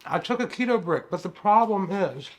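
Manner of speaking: talking through a mouthful; words round a bite of food; mouth full. Real, not acted. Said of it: "Don't talk with your mouth full".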